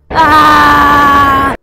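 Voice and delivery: loud shouted scream mimicking the rider; imitated scream. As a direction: belt out her scream. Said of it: "she would scream".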